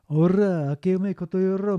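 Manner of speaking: breezy, casual "ake ome koto yoro"; casual slang greeting. Shown as a demonstration. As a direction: toss off the slang greeting like a friend. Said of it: "mitaina kanji no bunchō ga".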